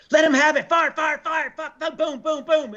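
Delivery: high yelled orders and booms; hammed-up combat yell, boom boom; retold battle yell. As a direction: shout the fire command over gunfire. Ordering Marines to fire.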